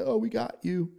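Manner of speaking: high-pitched imitation of a teaser; mock gloating. Provokers run away after winding someone up, mock gloating.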